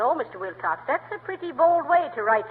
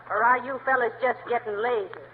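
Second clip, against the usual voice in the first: normal talking voice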